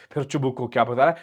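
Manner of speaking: ordinary talk, nothing marked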